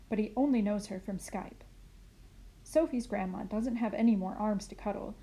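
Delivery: ordinary delivery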